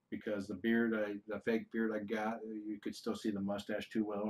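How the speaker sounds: ordinary talk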